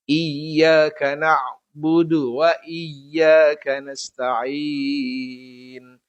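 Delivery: slow, measured chant with long held endings; Qur'an recitation. Shown as a demonstration. Teacher reciting Al-Fatihah for the lesson, slow melodic recitation. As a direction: recite verse by verse, stretching the final vowels.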